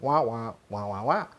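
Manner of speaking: mimicking muffled cartoon adult talk; tuning her out like a cartoon voice; cartoon wah-wah. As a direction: drone a falling wah wah wah. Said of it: "It just sounded like cartoon after that".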